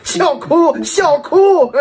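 laughing. Acted. Emoji read with laughter.

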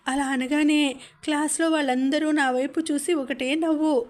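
as a little girl, mimic the mocking teacher's dialect while telling mother; hurt schoolgirl retelling. (చిన్నపిల్ల గొంతుతో మాస్టారి వెక్కిరింపు చెప్తూ)

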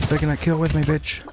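high, loud gloating; triumphant taunt. Real, not acted. Gamer gloating loudly at the enemy he took down. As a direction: gloat over the kill.

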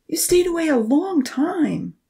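high anxious question; worried, slow and pleading. A narrator's reading. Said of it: "he asked anxiously".

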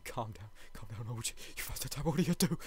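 ordinary talk, nothing marked

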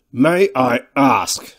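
slow, loud, heavy question; imposing big man's slow challenge; commanding thick man. A narrator's reading. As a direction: draw it out with weighty authority. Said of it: "His voice had all the qualities".

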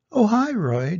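a touch of guilty nerves; gulped greeting. A narrator's reading. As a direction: sound caught out, trying to be casual. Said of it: "Jarvis gulped".

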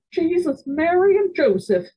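high, loud scandalized cry; shocked exclamation. A narrator's reading. High horrified cry. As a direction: grandmother exclaiming at the news. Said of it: "interjected my grandmother".

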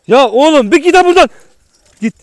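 loud, high yell to chase it off; shouting. Real, not acted. Yelling at the dog to leave him alone, furious shout. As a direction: bellow it out, really angry. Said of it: "Şimdi böyle bağırıyorum".

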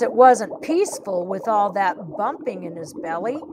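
plain reading voice

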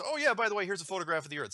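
mock offhand. NASA mocked as casually revealing the long-awaited photo, breezy. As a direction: toss it off like it's no big deal.

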